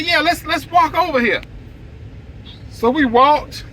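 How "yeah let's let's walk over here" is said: eager to get going. Retold or imitated. Eager reply, retold.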